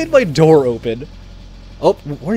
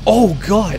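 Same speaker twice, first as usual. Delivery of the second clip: startled; 5 semitones higher, 5 dB louder than usual. Real, not acted. Hit by an unseen car.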